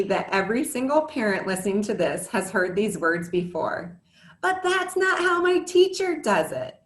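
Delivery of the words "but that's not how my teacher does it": put-on whiny kid. Kid's complaint quoted by parent.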